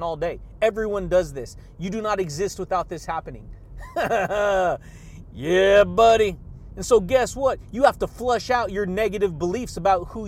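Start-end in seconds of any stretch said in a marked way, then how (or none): 3.5-5.3 breathy amused laugh. Real, not acted. Laugh after his point. 5.3-6.4 loud, hyped cheer; loud catchphrase. Real, not acted. Shouted catchphrase.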